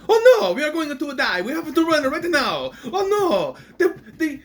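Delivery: high, loud hammed-up Italian accent; panicked mock-Italian wail. Movie fan parodying Pompeii citizens fleeing, hammed-up Italian accent. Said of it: "Shouldn't they be speaking with an Italian accent?".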